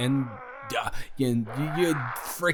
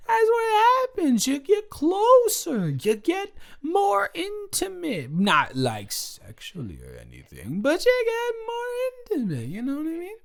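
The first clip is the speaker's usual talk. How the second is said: high defensive; 14 semitones higher than usual. Real, not acted. Tipsy defending calling him baby.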